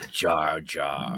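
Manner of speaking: Jar Jar voice. Acted. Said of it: "the one and only".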